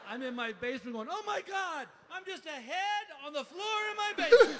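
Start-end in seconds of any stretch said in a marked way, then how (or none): 1.1-4.6 high, loud comic panic; hammed-up freak-out, pitched way up. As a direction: wail it like a stoner in full panic. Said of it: "I pretty much freaked out".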